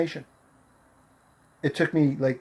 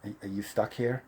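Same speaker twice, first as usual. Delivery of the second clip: hesitant stranger; 4 semitones lower, 10 dB quieter than usual. Retold or imitated. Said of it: "He goes".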